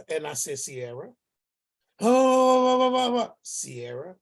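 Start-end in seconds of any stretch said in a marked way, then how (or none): 0.6-1.1 calming. Retold or imitated. Uncle calming upset niece. 2.0-3.3 mock rant. Mimicking niece's heated rant. 3.4-4.2 calming. Retold or imitated. Uncle calming upset niece.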